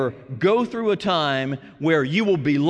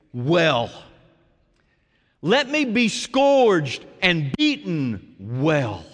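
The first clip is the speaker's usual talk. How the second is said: slow solemn cadence; much slower than usual. Real, not acted. Preaching Jesus' surrender.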